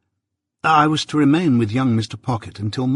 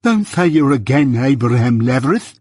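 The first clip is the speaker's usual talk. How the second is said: lisping suitor, pleading and louder; excitable plea; 2 semitones higher, 5 dB louder than usual. A narrator's reading. Suitor begging Jaggers not to take the other side, panicky. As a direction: beg him in a panicky lisp. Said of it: "cried my excitable acquaintance".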